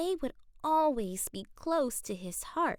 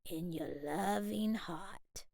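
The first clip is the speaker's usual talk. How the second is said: gentle grandfather voice, lower and softer; tender, slow, softer; 8 semitones lower, 3 dB quieter than usual. A narrator's reading. Grandfather comforting his grandson before he leaves, tender and slow. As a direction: soothe him slowly and softly. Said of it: "Abuelo said".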